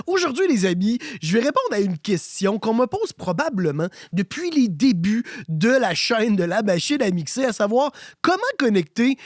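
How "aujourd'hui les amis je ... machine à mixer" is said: normal talking voice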